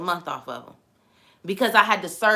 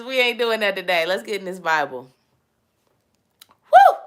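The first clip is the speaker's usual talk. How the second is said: normal talking voice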